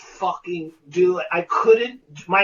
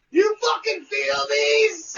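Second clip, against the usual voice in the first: much higher, slow, pointed question; intense director; 10 semitones higher than usual. Retold or imitated. Director pressing the actor's hand, slow and intense. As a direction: lean in and make him feel it. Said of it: "he goes".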